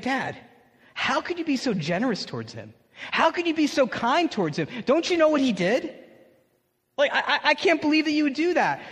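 put-on indignant older brother. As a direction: protest like the wronged son. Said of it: "He's like".

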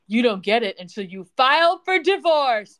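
building to a loud soap-opera reveal; mock dramatic ultimatum. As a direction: deliver the cliffhanger condition, punching the ending loudly. Voices Daddy's cliffhanger condition.

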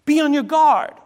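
loud, high urgent warning. Retold or imitated. Preacher voices Jesus warning the crowd, urgent and loud. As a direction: warn them with force. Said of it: "When Jesus says".

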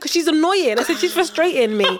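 high-pitched defensive grumble; retold complaint. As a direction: replay the grievance with pitch lifted. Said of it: "You shouting at her".

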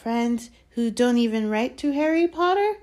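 lightly voiced sly house-elf; a hint of a sly little elf. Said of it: "said Dobby slyly".

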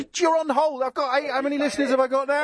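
high-pitched, indignant ticking-off; mock-exasperated scolding. Real, not acted. Scolds a caller caught unready on air.

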